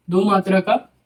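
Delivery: slow dictation, each word spaced for note-taking. Shown as a demonstration. Teacher to students: slow dictation.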